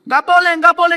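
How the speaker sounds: high, loud flat refusal; doctor refusing. Retold or imitated. Doctor refusing to let the mother go home, loud and high. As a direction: bark a firm no like the doctor. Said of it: "kata dokter".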